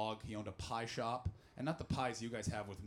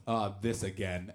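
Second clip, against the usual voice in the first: weary reaction; 6 semitones lower, 4 dB louder, slower than usual. Retold or imitated. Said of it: "then the microphone goes".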